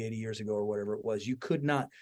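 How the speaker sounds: ordinary talk, nothing marked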